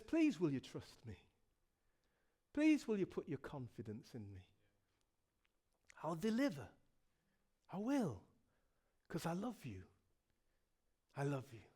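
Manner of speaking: low, hushed, intimate appeal with long pauses; tender pleading. Acted. Preacher as God asking listeners to trust, low tender plea. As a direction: plead softly and gently, letting each phrase settle.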